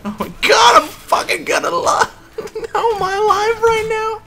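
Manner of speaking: high laughing cries; disbelieving shriek. Real, not acted. Survived by luck.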